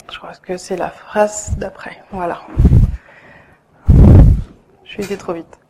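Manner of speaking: whispered aside to herself; whispering. Real, not acted. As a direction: drop to a whisper. Aside while finding her place.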